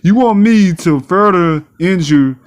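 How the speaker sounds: usual speaking voice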